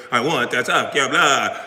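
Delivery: lightly put-on Dracula, mock vampire; a quick hint of a mocking Count Dracula. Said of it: "looking like Dracula".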